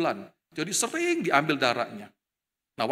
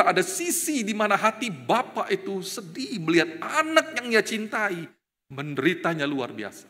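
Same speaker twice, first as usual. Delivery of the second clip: ordinary talk, nothing marked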